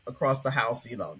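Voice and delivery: ordinary delivery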